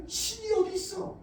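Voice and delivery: a hint of a sneering doubter; scornful sneer. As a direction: throw doubt at the believer. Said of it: "사람들이 내게 말합니다".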